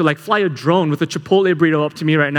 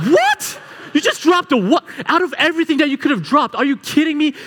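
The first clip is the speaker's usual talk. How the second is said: high cracking shout of disbelief, laughing; much higher mock-outraged cry; incredulous shout; 8 semitones higher than usual. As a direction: yell up the wall in outraged disbelief. Disbelief at the dropped coffee.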